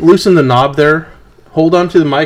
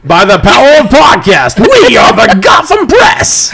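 heroic proclamation; 8 semitones higher, 4 dB louder than usual. Pretend. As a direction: declare it like a cartoon hero. Mock transformation cry.

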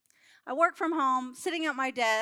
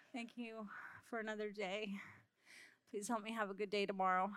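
tired bedtime prayer; 4 semitones lower, 14 dB quieter than usual. Retold or imitated. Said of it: "go to bed and be like".

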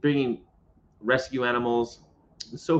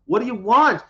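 higher, louder "what do you want"; called back; 10 semitones higher, 5 dB louder than usual. Retold or imitated. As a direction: shout back across the lot. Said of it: "and I'm".

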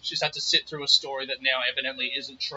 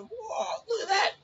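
much higher, awestruck gasp of wonder; amazed whoa; 4 dB quieter than usual. Retold or imitated. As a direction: marvel at the snake. Grandpa's bloke amazed at snake.

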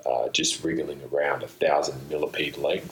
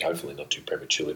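rushed aside; 4 dB quieter, much faster than usual. Real, not acted. Quick aside.

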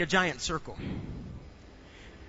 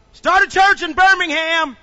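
loud, high divine order; voicing God loudly; booming command; 11 semitones higher, 13 dB louder than usual. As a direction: proclaim it as God's voice. God's command quoted.

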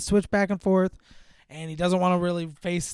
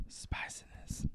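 hushed, coy whisper; whispered euphemism; 18 dB quieter, slower than usual. Real, not acted. Recapping a racy movie scene, coy whisper. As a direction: whisper it like a naughty word.

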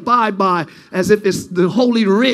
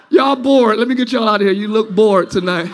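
ordinary talk

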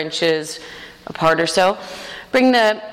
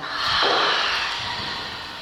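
long whispery sigh out through the mouth; open-mouthed, voiceless breath out; whispered exhale; 5 dB quieter than usual. Shown as a demonstration. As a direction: demonstrate a whispered breath sound. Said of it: "whisper a sound".

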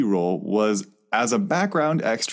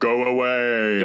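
mock heaven voice; 2 semitones higher, much slower than usual. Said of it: "It just says the voice again".

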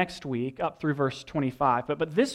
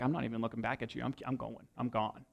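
softer, fast mumbled dismissal; tossed off without looking back; mock Frodo brushing him off; dismissive brush-off; 4 semitones lower, 12 dB quieter, faster than usual. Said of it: "And Frodo's like".

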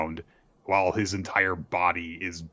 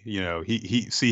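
ordinary delivery

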